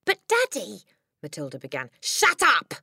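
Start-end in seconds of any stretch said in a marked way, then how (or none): 0.0-0.8 put-on high-pitched little-girl protest; pleading child. 2.0-2.7 high, loud father's bellow; angry shout. A narrator's reading. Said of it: "the father shouted".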